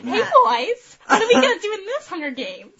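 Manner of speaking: Barbie voice. Pretend. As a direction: squeal it like a giggly Barbie. [name] voicing Barbie doll.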